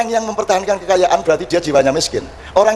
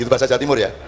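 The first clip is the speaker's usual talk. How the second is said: ordinary delivery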